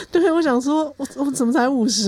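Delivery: real laughter lifting the voice; amused laughter. Real, not acted. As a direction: laugh your way into the words. Laughing over the age mix-up.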